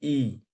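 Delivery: ई drawn out clearly and held; vowel demo. Shown as a demonstration. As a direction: sound out ई slowly for students. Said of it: "आप जैसे बोलिए ई". (स्वर ई को लंबा खींचकर बोलें)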